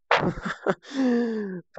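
high burst of laughter trailing down; amused laugh. Real, not acted. Gamer delighted by an unexpected game feature, high falling laugh.

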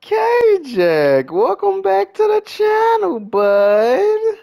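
very high-pitched, loud welcome; excited greeting. Real, not acted. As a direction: greet a regular in a gleeful high voice. Greeting a returning viewer by name.

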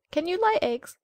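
high, loud, haughty hen voice; bossy hen. Acted. Hen quizzing the duckling, high and haughty. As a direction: peck the question out like a proud hen.

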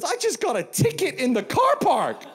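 high-pitched loud mock yell; mock outraged girl. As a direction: imitate an indignant girl shrieking her complaint. Mimics upset girl complaining about ticket.

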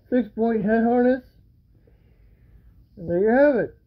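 muffled behind face mask; speaking through gas mask. Real, not acted. Seen 4: Full-face gas mask worn over mouth throughout all frames; references unmasked.

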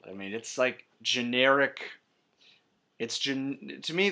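ordinary talk

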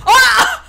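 loud shocked cry; shocked scream. Real, not acted. Shout after being killed.

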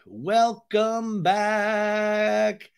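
high, very drawn-out announcer call; hammed-up host welcome. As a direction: stretch out each word like an opener. Exaggerated host's greeting, stretched.

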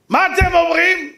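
high, loud appeal; calling out. Retold or imitated. Asking three hundred people to judge the argument, loudly calling out. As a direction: shout the question to the whole room.

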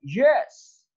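"यस" pitched high, dropping at the end; falling tone. Shown as a demonstration. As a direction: say "यस" as a high fall. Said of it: "यस fall कर रहा है".

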